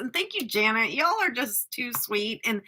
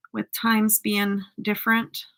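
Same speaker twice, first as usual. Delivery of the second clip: ordinary delivery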